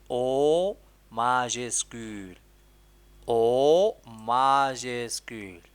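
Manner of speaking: drawn-out teacher dictation; slow dictation. Shown as a demonstration. Teacher repeating the dictation to the pupil, very slowly. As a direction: stretch "O majuscule" out word by word.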